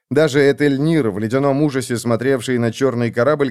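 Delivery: ordinary delivery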